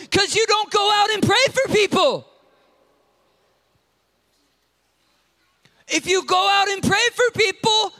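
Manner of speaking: high, loud fervent preaching; preaching at full force. Real, not acted. Preacher exhorting the congregation, full-force preaching. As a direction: drive it home to the crowd.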